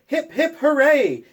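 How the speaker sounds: high, loud happy cheer. Acted. Parents cheering the baby-saving hero, high loud cheer. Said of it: "Remember how we cheered".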